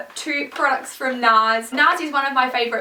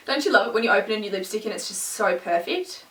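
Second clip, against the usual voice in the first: usual speaking voice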